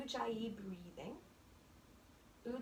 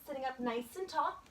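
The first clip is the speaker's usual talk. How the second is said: guided meditation. Acted. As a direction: ease them into stillness. Yoga teacher guiding settling in.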